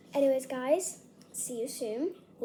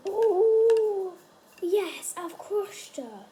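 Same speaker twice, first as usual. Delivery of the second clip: high, loud delight; excited; 7 semitones higher, 9 dB louder, much slower than usual. Real, not acted. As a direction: cheer as it breaks. Finally crushes bomb.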